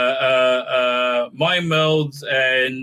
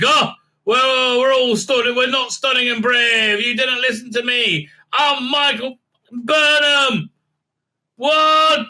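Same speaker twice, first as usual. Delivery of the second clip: high, loud indignant wail; hammed-up protest, stretching into a shriek; mock Burnham outburst; 9 semitones higher, 3 dB louder, slower than usual. Burnham objects that nobody listened to her, in mock high-pitched indignation.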